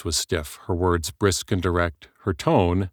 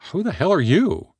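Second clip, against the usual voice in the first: sharp, higher, louder challenge; hostile demand; 12 semitones higher, 4 dB louder than usual. Acted. Heather confronting a stranger on her phone, hostile. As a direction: bark it at an unwelcome stranger.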